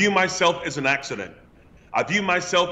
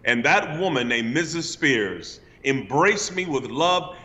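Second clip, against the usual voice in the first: ordinary talk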